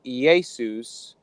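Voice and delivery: "Iesus" stretched out as ee-ay-soos; Greek pronunciation. Shown as a demonstration. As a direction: say "Iesus" slowly with a long final oo. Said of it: "sounds like Jesus".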